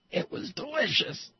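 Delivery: delicious lifted high and sung out; high sing-song. Pretend. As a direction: pitch it way up, a mock-gushing delight. Kids' smoothie praised, echo.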